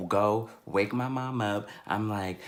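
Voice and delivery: ordinary delivery, nothing marked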